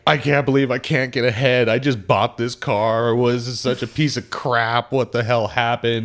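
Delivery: mock upset rant. Retelling a customer losing it over her car, mock upset. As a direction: play her falling apart over the car.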